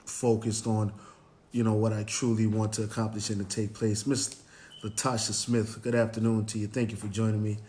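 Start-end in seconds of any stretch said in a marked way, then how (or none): none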